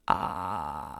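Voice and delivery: low, drawn-out creaky "ah"; long fried ah; vocal fry. Shown as a demonstration. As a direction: hold a crackling low fry. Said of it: "start to hear that sort of".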